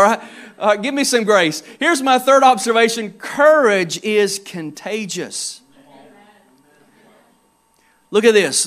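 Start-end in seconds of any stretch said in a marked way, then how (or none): 3.1-5.6 slow, weighty, each word set apart; slow emphatic statement. Real, not acted. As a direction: land the main point slowly. Key point stated slowly.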